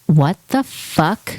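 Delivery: disbelief. Retold or imitated. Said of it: "even Mike was like".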